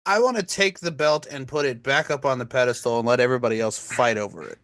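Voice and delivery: ordinary talk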